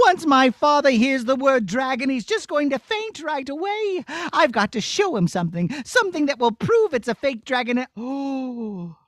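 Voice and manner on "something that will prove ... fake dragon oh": normal talking voice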